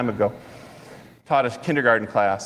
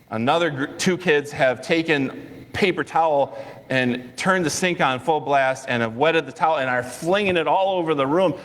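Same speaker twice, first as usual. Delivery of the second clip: ordinary talk, nothing marked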